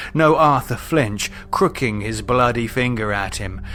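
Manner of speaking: usual speaking voice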